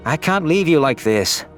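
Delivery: plain reading voice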